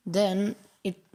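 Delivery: ordinary talk, nothing marked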